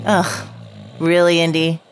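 ordinary talk, nothing marked

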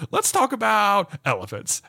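lightly voiced child's suggestion; retold eager child. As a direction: propose it like an excited little boy. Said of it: "be like".

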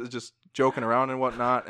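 ordinary talk

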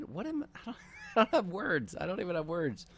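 high, breaking into a laugh; laughing in disbelief. Real, not acted. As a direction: speechless laughter. Stunned by odd video.

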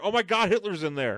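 high, laughing exclamation of discovery; mock shock. Examining her ear and finding Hitler, mock shocked. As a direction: announce an absurd find in pretend horror.

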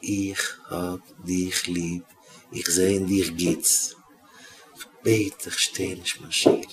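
very soft, low and slow; tender reassurance. Retold or imitated. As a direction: soothe the child with each drawn-out word. Teacher reassures child, gentle plea. (גאר שטיל, לאנגזאם און ליבלעך)

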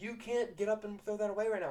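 mock scolding. Pupils correcting their teacher, high mock scolding. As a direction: tell off the culprit in kid fashion.

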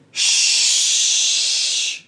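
a sharp whispered shush. Acted. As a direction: hush them with a breathy shh. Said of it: "said the beaver".

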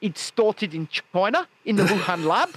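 put-on South African rant; South African accent. Host blaming a lab for Omicron, South African accent. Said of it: "the South African accent is the one".

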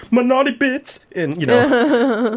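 mock a coy Southern euphemism; imitating a prudish churchgoer.

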